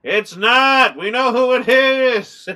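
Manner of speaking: loud, high, slowed insistence; yelling at the screen. Real, not acted. Viewer yelling at the film's characters, loud and emphatic. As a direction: shout at the movie like it can hear. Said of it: "I'm doing the whole Rainey thing".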